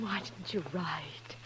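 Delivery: hushed whispered reproach. Acted. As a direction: breathe it out, barely voiced. Sister reproaches brother on reunion.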